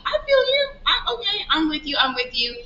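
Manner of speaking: high, eager I feel you, settling down; enthusiastic agreement. Retold or imitated. To the preacher in church, eager agreeing. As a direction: cheer the preacher on.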